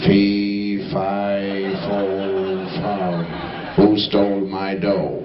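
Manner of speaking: low, slow giant's boom; father playing the giant hunting his money. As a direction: rumble it like a storybook giant.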